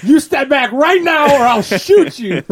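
high, loud mock scolding; imitated threatening yell. Scaredy-cat friend ordering them back, hammed-up yell. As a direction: yell it like a frantic, bossy mother hen. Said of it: "calls for our safety that John would throw out".

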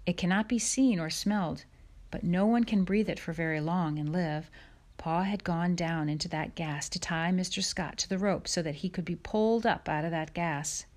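usual speaking voice

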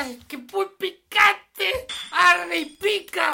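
burning mouth cry. Acted. Tastes spicy paella, mouth burns.

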